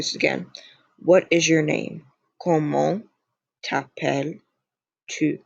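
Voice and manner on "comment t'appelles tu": "Comment t'appelles-tu" spaced out slowly; slow French demonstration, word by word; slow model phrase. Shown as a demonstration. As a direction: teach it slowly, a pause after "Comment".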